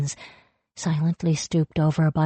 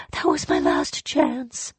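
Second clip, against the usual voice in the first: fading despair; 8 semitones higher, slower than usual. Acted. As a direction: let it die away. Repeated, fading.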